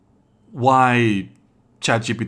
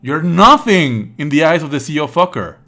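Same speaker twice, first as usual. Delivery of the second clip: higher and louder indignant rant; heated rant; 6 semitones higher, 10 dB louder than usual. Real, not acted. Streamer to chat siding with the character, loud and indignant. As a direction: get worked up on his behalf. Riled up taking character's side.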